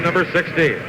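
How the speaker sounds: ordinary delivery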